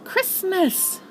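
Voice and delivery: excited. Acted. Tigger's joking answer.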